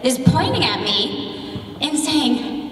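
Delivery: usual speaking voice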